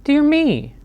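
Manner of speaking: high worried squeak; fretting mouse. Acted. Mouse fretting over sleepless Bear, high and worried. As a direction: fret in a small high voice. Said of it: "Mouse frets".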